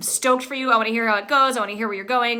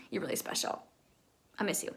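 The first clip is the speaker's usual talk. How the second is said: near whisper; 3 semitones lower, 14 dB quieter, faster than usual. Real, not acted. Emotional farewell to student.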